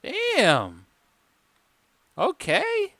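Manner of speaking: high stunned okay; surprised. Real, not acted. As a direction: squeak it out, impressed. Still stunned by record.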